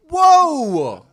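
loud high whoa; mock outrage. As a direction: cry out, playfully offended. Mock offended outcry.

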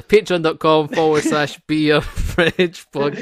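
slow, drawn-out bingo caller voice. Shown as a demonstration. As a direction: call it out like bingo numbers. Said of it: "I put my bingo, my bingo voice on at the end".

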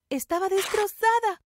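plain reading voice

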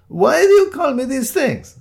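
high, loud, wounded outburst; aggrieved protest. Acted. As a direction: wail the question in exasperation. Delivery man protests the names.

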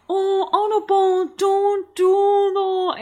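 high, exaggerated mother voice; hammed-up motherly voice; running-joke mum impression. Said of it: "impression of my mum".

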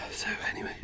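breathy whisper. Real, not acted. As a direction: drop to a whisper. Unvoiced aside before breath remark.

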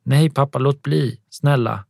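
high, loud desperate cry to stop; screaming plea. A narrator's reading. Girl pleading with her father not to hit mum, screaming. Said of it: "Cecilia skriker".

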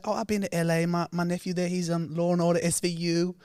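high, lightly voiced older woman gushing; Auntie voice. Retelling the New York auntie to a friend, high cooing voice. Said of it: "she's like".

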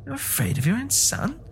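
high, incredulous mother; frantic old woman. A narrator's reading. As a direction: fling it at him. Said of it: "she cried, struggling".

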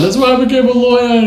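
high, whiny mock sob; mock crying wail. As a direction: whimper it like a hurt son, hammed up. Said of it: "and crying kind of thing".

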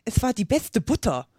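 etwas höher, zaghaft demütig; leicht demütig rechtfertigen. A narrator's reading. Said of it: "sagte der Fasehase demütig". (mit einem Anflug von Demut)

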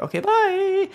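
okay, bye, jumped way up high; a silly high-pitched goodbye; squeaky sign-off. Acted. Reading review sign-off in squeaky voice.

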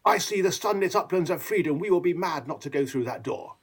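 hammed-up grand optimism; Boris. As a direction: boom it out like a showman politician. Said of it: "But Boris just says".

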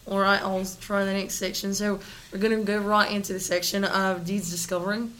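put-on Australian segment intro; Australian accent. Introducing the next segment on request, Australian accent. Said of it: "Can you use that accent".

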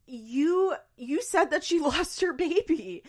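high, slow accusing wail; mock accusation. Imitating commenters blaming the nurse, mock accusation. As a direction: drag out the accusation, half laughing. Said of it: "people were like".

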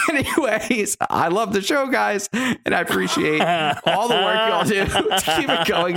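high-pitched, struggling through laughter; laughing while reading. Real, not acted. Host finishing a listener's email after a crude joke, laughing. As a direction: try to read on while cracking up.

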